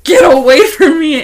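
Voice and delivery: high, loud push-away; loud brush-off. Retold or imitated. Telling the odd coworker to get away, loud. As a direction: shove her off with your voice.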